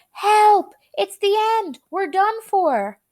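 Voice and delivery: very high, loud cry for help; terrified shout. A narrator's reading. Grandpa Joe cries out as the roof breaks, high and loud. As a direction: yell like it's the end. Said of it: "Grandpa Joe shouted".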